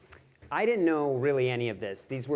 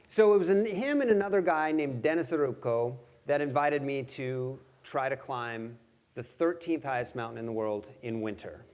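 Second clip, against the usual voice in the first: ordinary talk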